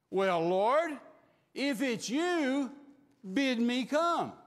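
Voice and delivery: loud, slow, drawn-out call; bold Peter calling. Acted. Peter calling out to Jesus, slow and loud. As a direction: stretch the plea across the water. Peter repeats his challenge.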